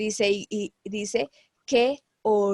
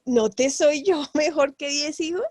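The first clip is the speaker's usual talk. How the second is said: laughing through quote; 5 semitones higher, 5 dB louder than usual. Retold or imitated. Husband's line, laughing.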